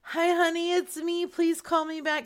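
high, put-on honeyed phone voice; mock sweetheart voicemail. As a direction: play the sweetheart leaving a message.

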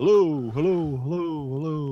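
loud, repeated mic-check calls; mic check hello. Real, not acted. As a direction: call out hellos to test the mic. Testing the microphone level.

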